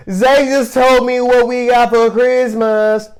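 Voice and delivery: little sister tattling loudly. Retold or imitated. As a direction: whine it to the parents. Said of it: "she goes".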